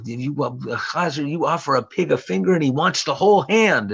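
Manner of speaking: high, loud nagging grandma voice; grandmother scolding. Retold or imitated. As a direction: scold him like an old grandmother. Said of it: "And she said".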